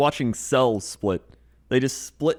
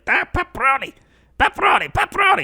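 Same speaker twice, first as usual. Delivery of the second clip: put-on much higher, louder squealing kid chant; freaking-out kid; 5 dB louder, faster than usual. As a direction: squeal pepperoni like a hyper child. Kid freaking out over toppings.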